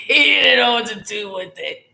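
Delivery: high, loud words through laughter; real laughter. Real, not acted. Recalling her twin so mad, laughing as she talks. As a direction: crack up while getting it out.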